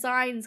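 "zines" with the i of lines; habitual misreading. Shown as a demonstration. Explaining the mistake to viewers, "zines" said as "zynes". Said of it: "in my head read it as zines".

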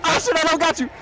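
loud, high and frantic; excited yelling. Real, not acted. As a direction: shout it mid-rescue. Rescue attempt, frantic yelling.

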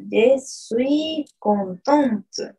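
slow, "contente" with the final T clearly sounded; slow careful French. Shown as a demonstration. Teacher correcting a student's Facebook answer slowly. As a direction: say "je suis contente" slowly, T on the end. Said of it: "Atención ahí".

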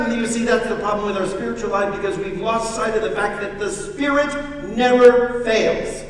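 loud, high pulpit preach; fervent sermon delivery; full-force preaching. Acted. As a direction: preach it out to the congregation.

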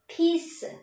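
Peace with puffed p and long "ee"; correct English pronunciation. Shown as a demonstration. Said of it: "những lần đọc tiếp theo nữa". (Peace bật hơi, kéo dài âm i)

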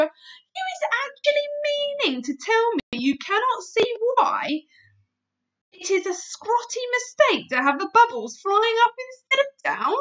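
exasperated big friendly giant; scolding giant. Acted. BFG scolds Sophie.

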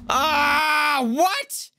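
high, loud, disbelieving wail; outraged disbelief. Real, not acted. Gamer losing progress, wailing high and loud in disbelief. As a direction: squeal it in sheer disbelief.

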